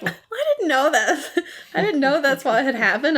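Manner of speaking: a light, high-voiced put-on of Jack's innocence; mock innocent Jack. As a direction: play the wide-eyed innocent, laughing through it. Jack faking innocence, retold.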